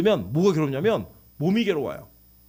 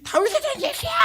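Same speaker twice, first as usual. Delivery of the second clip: screaming cry. Acted. Blind Bartimaeus calling to Jesus, high-pitched screaming cry. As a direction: scream it like someone on a ride. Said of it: "그 소리 지르듯이".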